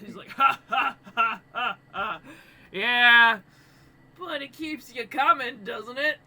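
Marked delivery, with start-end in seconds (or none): 0.3-2.2 big, loud, spaced-out belly laugh; loud Goliath chuckle. Acted. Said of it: "he just kinda chuckles". 2.7-6.2 loud, jovial giant voice, pitched up; Goliath barkeep. Acted. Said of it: "he's a Goliath".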